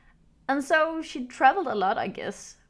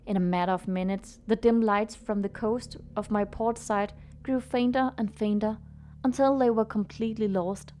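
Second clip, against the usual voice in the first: story narration. A narrator's reading. Reads the story over music.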